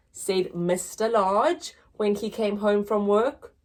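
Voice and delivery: ordinary delivery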